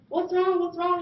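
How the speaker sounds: high, loud, urgent repeated question; worried mom. Retold or imitated. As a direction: mom rushing to her choking daughter, alarmed and loud. Mom voiced, panicking over choking child.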